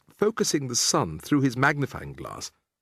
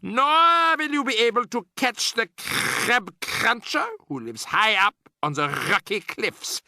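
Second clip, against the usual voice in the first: much higher, slow witch voice; menacing witch; 9 semitones higher than usual. A narrator's reading. Grand High Witch mocking the ancient witches, menacing. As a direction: sneer it out slowly.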